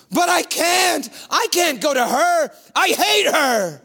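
high, loud bitter protest; anguished refusal. Acted. Becca refusing to go to the mother. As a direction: push back in anguish.

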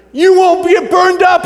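high, loud, stretched-out assurance; hammed-up storyteller's climax; hyped promise. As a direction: announce the miracle to kids with mock excitement.

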